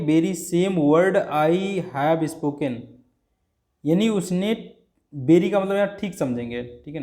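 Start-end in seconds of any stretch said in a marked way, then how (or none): 0.1-2.8 very same word I have spoken, said slowly; drawn out for the class; teacher slowing down on the example; slow emphatic demo. Shown as a demonstration. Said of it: "हम कहेंगे".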